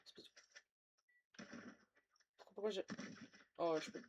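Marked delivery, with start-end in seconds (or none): none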